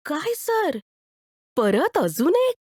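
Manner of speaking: put-on high, amazed girl's voice; can't believe he wants another; surprised shop girl. Shop girl to Bhaskarrao, amazed he is buying another ice cream.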